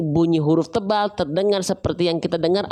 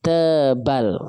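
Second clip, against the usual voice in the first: drawn-out emphasis; 6 semitones lower than usual. Shown as a demonstration. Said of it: "dibaca dengan keadaan te-, tebal".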